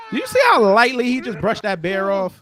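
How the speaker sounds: loud, high, near-crying disbelief; amazed shouting. Real, not acted. Streamer shouting at viewers in amazed disbelief.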